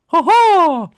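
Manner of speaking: very high, loud honking laugh; sudden weird yelped laugh, imitated; Jimmy Carr. Fan showing how the comedian laughs after deadpanning, weird mock laugh. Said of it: "like, very weird laugh".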